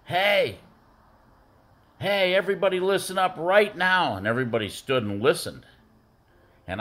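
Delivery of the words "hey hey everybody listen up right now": high, raised call for attention; retold shout to the team. Coach calling his team together before the game, rallying shout. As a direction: call the whole team to listen, pitched up high. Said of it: "I turned and I yelled to the team".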